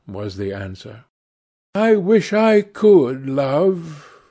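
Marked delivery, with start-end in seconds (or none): 1.7-4.2 high, drawn-out wistful plea; raised voice full of longing; deep pathos. A narrator's reading. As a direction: say it slowly with aching pathos. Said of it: "with a deep note of pathos in his voice".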